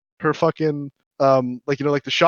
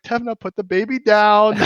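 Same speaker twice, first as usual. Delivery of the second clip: firm request; 6 semitones higher, 3 dB louder than usual. Retold or imitated. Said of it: "I was like".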